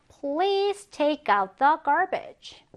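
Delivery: very slow request; slow example reading. Shown as a demonstration. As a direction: read it slowly for learners. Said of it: "쓰레기를 내놔주세요". (천천히 예문 읽기)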